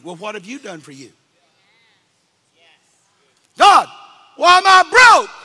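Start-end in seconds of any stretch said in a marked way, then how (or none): 3.5-5.3 high loud mock wail; hammed-up angry prayer; mock complaint to God. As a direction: cry it up at heaven, hammed-up. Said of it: "We get mad at God".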